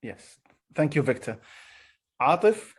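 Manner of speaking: usual speaking voice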